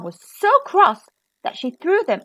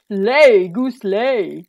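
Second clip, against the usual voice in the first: giant commanding his goose; loud first word, then easing off; giant's cry; 2 semitones lower, 7 dB louder, much slower than usual. A narrator's reading. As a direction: call out the order like a giant. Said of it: "he cried".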